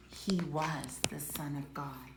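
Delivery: awed whisper. Acted. Roman soldier realising who Jesus was, soft awed whisper. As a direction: breathe it in awe.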